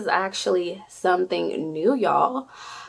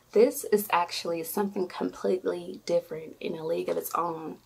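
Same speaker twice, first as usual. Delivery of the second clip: usual speaking voice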